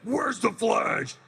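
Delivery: high, very slow monster voice; King Shark voice. Shown as a demonstration. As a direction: drag it out like a sea monster. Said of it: "that voice is like".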